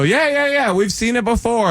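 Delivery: high, airy and dismissive; high breezy reassurance. Retold or imitated. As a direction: brush it off lightly. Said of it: "they go".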